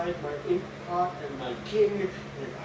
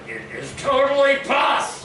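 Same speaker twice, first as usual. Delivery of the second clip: higher, loud indignant shout; angry shout; 8 semitones higher, 9 dB louder than usual. Acted. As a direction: yell it back in outrage. Acted quarrel, he loses temper.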